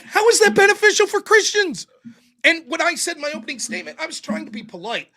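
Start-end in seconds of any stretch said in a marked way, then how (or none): 0.0-1.9 high, loud incredulous question; retold exasperation, pitched up and forceful; exasperated challenge. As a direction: demand it as in a heated debate. Reenacts challenging his debate opponent.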